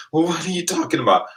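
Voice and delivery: high, loud baffled question with a laugh; mock confusion, pitched high; baffled huh. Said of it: "I'm like".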